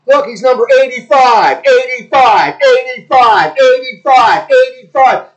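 loud, high, excited football commentary; mock play-by-play.

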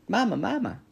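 put-on high, loud baby-bird cry for mama; little gosling voice, pitched high; peeping gosling. A narrator's reading. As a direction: peep it like a needy hatchling. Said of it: "peeped the gosling".

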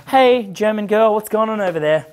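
acted hollered greeting; mock calling out. Acting out calling to a girl.

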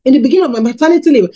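normal talking voice

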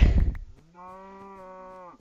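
high loud laugh burst; burst of laughter. Real, not acted. Gamer laughs as the cow dies, loud high laugh.

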